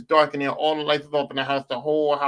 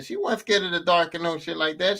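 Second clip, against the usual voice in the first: usual speaking voice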